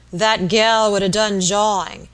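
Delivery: loud, slow rustic grumble; angry demand. A narrator's reading. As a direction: demand it loudly, dragging the words. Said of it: "demanding angrily".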